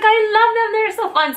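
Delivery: high bubbly quote; gushing friend. Retold or imitated. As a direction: gush it brightly. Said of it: "She's like".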